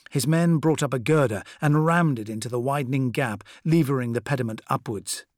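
usual speaking voice

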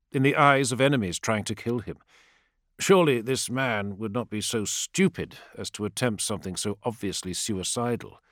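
usual speaking voice

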